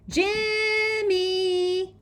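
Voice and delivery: long drawn-out high scream; strangled little-girl shriek, very high; high, twisted scream. A narrator's reading. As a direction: scream the name as if being strangled. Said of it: "Teresa screams, her voice high and twisted".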